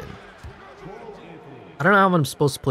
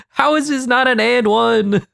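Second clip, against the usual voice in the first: laughing; 8 semitones higher than usual. Real, not acted. Laughing in disbelief.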